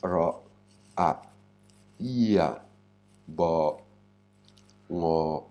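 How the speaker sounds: "Ra, a, ia, bo, ngo", each sound separately with pauses. Shown as a demonstration. Teacher breaking a Thai word into sounds, sounded out separately. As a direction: sound out "Ra, a, ia, bo, ngo" one at a time. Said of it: "qu'est-ce qu'on a comme son là".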